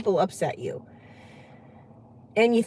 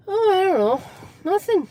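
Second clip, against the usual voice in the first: high, lightly acted noncommittal answer; evasive reply; 7 semitones higher than usual. Guest dodging a relative's questions, mock clueless and evasive. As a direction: shrug it off in a higher pitch.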